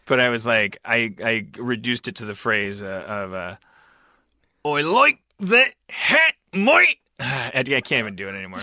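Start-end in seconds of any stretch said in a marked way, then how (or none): 4.6-7.0 put-on high, slow, pained Australian accent; strained, slow, high Australian accent, rising on mate. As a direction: force an Australian accent like it hurts. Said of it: "master the Australian accent".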